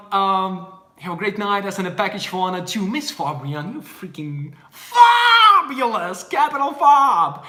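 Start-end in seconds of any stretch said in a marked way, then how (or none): 2.9-7.4 high, loud, drawn-out exclamation; hyped-up praise. Real, not acted. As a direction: shout praise, stretching the words out. Hyping up a fan.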